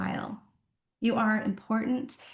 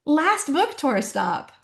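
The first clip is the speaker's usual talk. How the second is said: gushing fan post; 6 semitones higher than usual. Retold or imitated. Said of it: "post it and be like".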